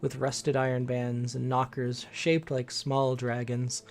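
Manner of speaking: story narration. A narrator's reading. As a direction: paint the scene like a storyteller. GM scene setting narration.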